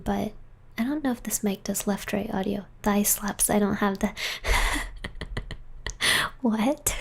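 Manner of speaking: usual speaking voice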